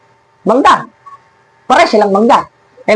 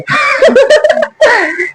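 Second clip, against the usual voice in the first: mocking laugh. Real, not acted. Preacher ridiculing a rival church's ugly name, mocking high laugh. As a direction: burst into high-pitched, derisive laughter.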